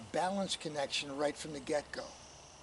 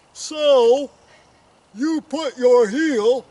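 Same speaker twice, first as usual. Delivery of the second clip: slow, high, over-the-top shout; exaggerated loud voice; 9 semitones higher, 20 dB louder, much slower than usual. Coach overdoing a request to be louder, slow mock shout. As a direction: boom each word out slowly, hammed up. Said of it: "could be a little bit louder".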